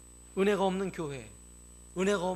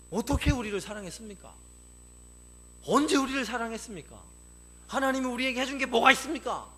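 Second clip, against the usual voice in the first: loud, high accusing questions; acted indignant protest to God; defiant challenge; 8 semitones higher, 8 dB louder than usual. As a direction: demand proof, building louder with each question. Acts Israel's defiant reply to God.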